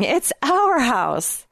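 high, slow, delighted reveal; laughing. A narrator's reading. He reveals their new house to her, laughing. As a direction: draw it out with amused delight. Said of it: "he said, laughing".

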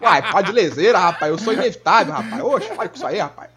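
put-on Northeastern accent. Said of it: "Homem de Ferro falando igual o Chico Bento".

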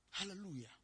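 ordinary talk, nothing marked

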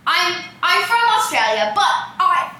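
imitated failing Aussie accent, breaking into laughter; mock slipping Australian accent. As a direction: stammer through a badly faked Australian accent. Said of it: "He's also dropping his accent".

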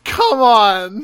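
loud, high cry of exasperation; mad, exasperated. Real, not acted. Host objecting to the name Jetter, exasperated. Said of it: "You sound mad".

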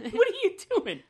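high laughing disbelief. Real, not acted. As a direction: laugh it out in shock.

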